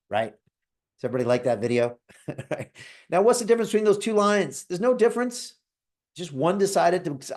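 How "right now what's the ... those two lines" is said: normal talking voice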